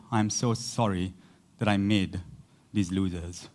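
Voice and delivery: low, soft mock lament from God; a low, soft sigh of mock regret. God paraphrased as sorry, in a low soft voice. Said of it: "That's what he's saying".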